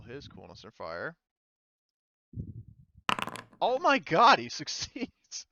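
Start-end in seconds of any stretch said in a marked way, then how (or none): 3.6-5.5 high, loud burst then laughing through the word; can't believe the roll worked, laughing; delighted laughing shock; laughing disbelief. Real, not acted. Unlikely success roll.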